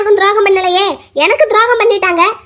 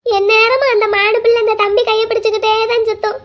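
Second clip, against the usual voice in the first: ordinary delivery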